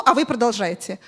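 ordinary delivery

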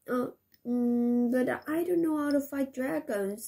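put-on hesitant, mildly worried boy; unsure of himself, a touch scared. Dan hesitates to fight dragons, mildly worried. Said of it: "in a worried voice".

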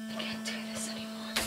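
fast, despairing whisper; broken whisper. Acted. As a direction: barely breathe it out, defeated. Breaking down alone.

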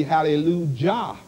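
"hallelujah" ending in a voiced "jah"; J-sound pronunciation. Shown as a demonstration. Preacher showing the correct pronunciation, "hallelujah" with hard J. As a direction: say "hallelujah" with J as in judge. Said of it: "correct pronunciation would be".

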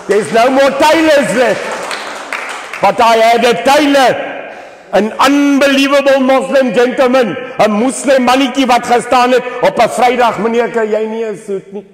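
high, loud platform shout; full-force rally oratory. Real, not acted. Speaker tells rally of lost tailors, full-force oratory. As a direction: cry it out high to the whole crowd.

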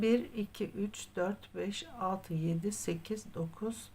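soft, murmured counting while knitting; counting under breath. Real, not acted. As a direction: count the stitches quietly to yourself.

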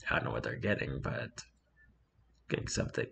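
whispered, trailing-off aside; muttered aside. Real, not acted. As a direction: drop to a whisper as an afterthought. Mumbled aside under breath.

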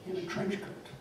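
normal talking voice

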